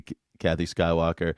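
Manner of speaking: ordinary talk, nothing marked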